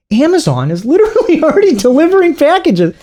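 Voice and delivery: high-pitched exasperated protest; exasperated retort. Acted. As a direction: argue back in squeaky disbelief. Exasperated imagined reply to the critic.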